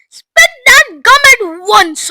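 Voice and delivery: high, loud, drawn-out command; shouted order. A narrator's reading. Mister Beauregard to his daughter, loudly ordering. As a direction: demand it urgently. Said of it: "ordered Mister Beauregard".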